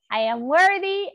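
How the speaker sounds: loud and high, full of conviction; with gusto. Shown as a demonstration. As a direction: belt it out proudly. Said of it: "Say it with gusto".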